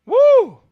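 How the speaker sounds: quick high cheer; high woo. Real, not acted. Streamer whooping after Seahawks lead. Celebrating the score.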